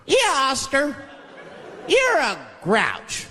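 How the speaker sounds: put-on high, slow taunting kids; chorus of kids. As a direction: tease Oscar like a preschooler. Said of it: "They judge him".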